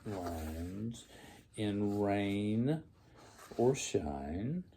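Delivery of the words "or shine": ordinary delivery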